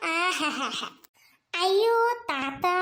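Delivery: slow, low hammed-up oppari sob; fake wail. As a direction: drag out ஐயோ தாத்தா mockingly. (போலி ஓலம்)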